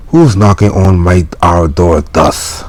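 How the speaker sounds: put-on old English phrasing. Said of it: "In the old English way".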